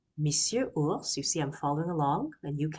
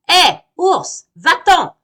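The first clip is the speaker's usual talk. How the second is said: very high, loud bee voice; a tiny bee shouting the bear away; shrill buzzy bee shout; angry little bee; 12 semitones higher, 14 dB louder, slower than usual. Acted. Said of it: "dit l'abeille".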